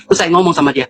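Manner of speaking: ordinary delivery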